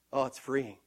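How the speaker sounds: ordinary talk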